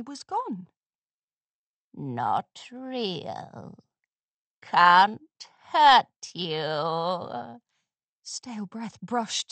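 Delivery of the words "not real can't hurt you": low, very slow sinister hag; mocking crone. A narrator's reading. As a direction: drag it out to taunt the filly. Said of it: "Olden pony mocks them".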